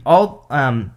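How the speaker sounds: choking mid-sentence; throat catching, coughing through words. Real, not acted. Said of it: "sorry, I'm choking now".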